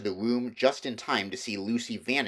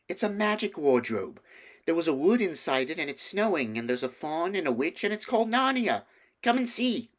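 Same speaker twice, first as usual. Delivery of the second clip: put-on higher girl's voice full of wonder; a child bursting to share a secret; excited young Lucy; 8 semitones higher than usual. A narrator's reading. Lucy tells of Narnia.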